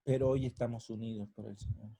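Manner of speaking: normal talking voice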